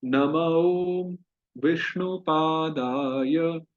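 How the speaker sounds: very slow, every syllable drawn out; slow repeat-after-me. Shown as a demonstration. Teacher leads students to repeat the mantra, very slowly. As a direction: chant it slowly for the class to copy. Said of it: "मेरे पीछे उच्चारण कीजिए".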